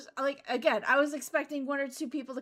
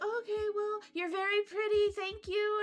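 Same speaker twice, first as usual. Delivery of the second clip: put-on sweet older lady; 8 semitones higher than usual.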